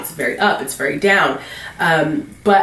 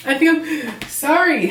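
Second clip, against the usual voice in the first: talking through hiccups; 8 semitones higher, slower than usual. Real, not acted. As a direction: voice catching with hiccups. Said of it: "I have the hiccups".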